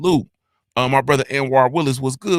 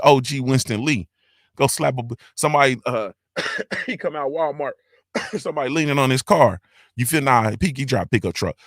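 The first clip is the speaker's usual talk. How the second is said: ordinary talk, nothing marked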